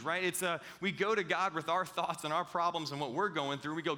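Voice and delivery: ordinary talk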